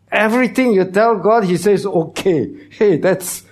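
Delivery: high, loud emphatic preaching; preaching climax. Acted. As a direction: drive it home to the crowd.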